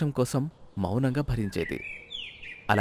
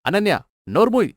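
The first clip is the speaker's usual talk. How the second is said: higher, louder snap with stress on నోర్మోయ్; furious husband shutting his wife up; angry shout; 7 semitones higher, 7 dB louder than usual. Acted. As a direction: bark it to silence her. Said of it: "కోపంతో".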